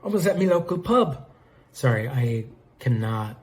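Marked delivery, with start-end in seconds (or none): 0.0-1.3 higher, exaggerated Aussie pub-goer; bad Australian accent. Lord Raptor answers the questioning, poor Australian accent. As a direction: attempt a broad Australian accent, badly. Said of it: "an Australian accent".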